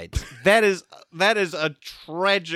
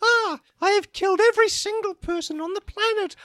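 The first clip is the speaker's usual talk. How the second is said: much higher, strained monster lament; Candy monster voice. Acted. Candy alone after killing everyone, high monstrous wail. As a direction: wail it like a monster who ran out of men.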